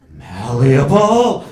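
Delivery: loud, drawn-out cry of insight; imitated eureka shout. Urologist has a moment of insight, imitated eureka shout. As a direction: stretch it out as a triumphant exclamation.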